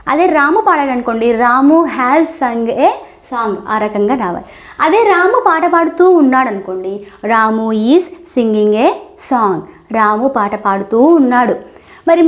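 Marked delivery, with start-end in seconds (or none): 1.5-3.5 each word slowly and separately for learners; deliberate teacher pacing; slow model sentence. Shown as a demonstration. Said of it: "teacher models the sentence". 7.3-9.6 each word slowly and separately for learners; deliberate teacher pacing; slow model sentence. Shown as a demonstration. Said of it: "teacher models the sentence".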